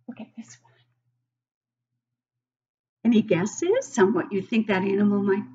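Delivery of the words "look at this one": hushed whisper. Real, not acted. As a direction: lean in and whisper it. Shows children the next page.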